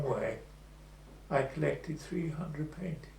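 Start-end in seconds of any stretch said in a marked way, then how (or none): none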